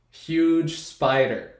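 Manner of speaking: ordinary delivery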